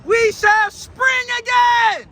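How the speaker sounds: high, loud, stretched proclamation; exultant preaching shout. Acted. Preacher exults in quoted line.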